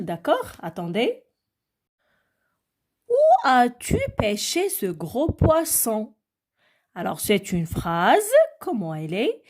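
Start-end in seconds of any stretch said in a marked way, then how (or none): 3.1-6.1 slow, higher question read to the class; reading an example aloud. Shown as a demonstration. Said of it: "je vais vous montrer quelques exemples".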